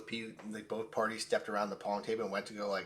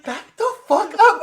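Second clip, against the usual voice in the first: high, drawn-out shout; slow, forceful retold yell; re-enacted angry command; 17 dB louder, slower than usual. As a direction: yell at a friend to stand down, acting it out. Acting out ordering friend to back off.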